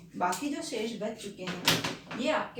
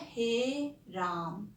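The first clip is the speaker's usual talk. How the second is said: हे राम very slow, with the हे held long; हे राम drawn out, हे held for three beats; long, slow Hey Ram; much slower than usual. Shown as a demonstration. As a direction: stretch हे राम out like a last dying breath. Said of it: "तो उन्होंने कैसे बोला था?".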